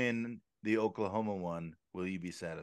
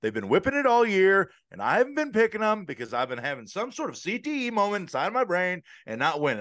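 ordinary talk

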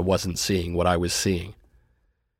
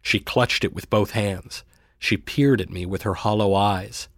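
ordinary delivery

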